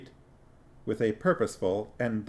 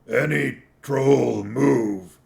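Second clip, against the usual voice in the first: troll voice; 3 semitones higher, 6 dB louder, much slower than usual. A narrator's reading. Detritus slow threat.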